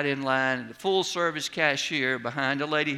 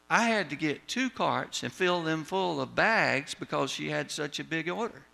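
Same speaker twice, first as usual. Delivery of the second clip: ordinary talk, nothing marked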